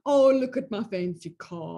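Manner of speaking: snide, put-on voice aping the husband's pride; mocking husband. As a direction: sneer as you parrot his bragging about the car. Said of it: "she mocks him".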